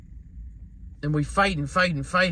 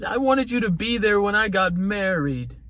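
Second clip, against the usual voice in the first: imitated crying daughter; 6 semitones higher than usual. Daughter begging her father to live, imitated hysterical crying. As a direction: retell a child pleading through tears. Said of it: "she's still crying hysterically".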